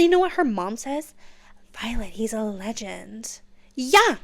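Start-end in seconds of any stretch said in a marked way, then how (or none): 1.7-3.4 hushed, reverent whisper; awed whisper. Retold or imitated. Mother stunned by the dragon, whispering in awe. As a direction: breathe it in awe.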